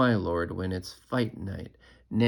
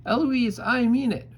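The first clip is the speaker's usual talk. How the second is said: put-on high, loud childish shout; 7 dB louder than usual. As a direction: yell it right back. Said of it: "And then I shout".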